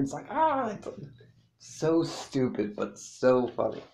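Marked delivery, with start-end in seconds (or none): 0.3-0.7 high, drawn-out pretend shriek; mock scream. Friends seeing the dinosaur jump the water, high mock scream. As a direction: cry out in high-pitched mock alarm.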